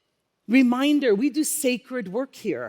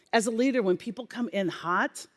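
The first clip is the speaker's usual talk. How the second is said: normal talking voice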